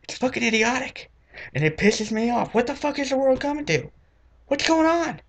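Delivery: high, strained exasperation; high-pitched comic outrage; exasperated rant. Real, not acted. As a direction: vent in disbelief. Closing rant about country stars.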